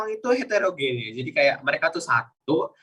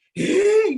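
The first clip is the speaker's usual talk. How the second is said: very high, stretched "Eh"; high, drawn-out questioning "Eh"; drawn-out intonation; 4 dB louder than usual. Shown as a demonstration. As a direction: pull "Eh" upward and hold it high. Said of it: "kayak ada yang ditarik".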